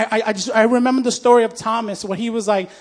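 ordinary talk

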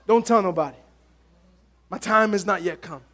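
Jesus quietly warning the healed man; hushed aside. Retold or imitated. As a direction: keep it between us, low.

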